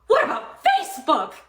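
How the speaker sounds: high, hammed-up gasp of shock; a staged discovery that Facebook lies too; mock gasp. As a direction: gasp like the world just ended.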